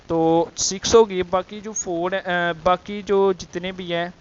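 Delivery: ordinary delivery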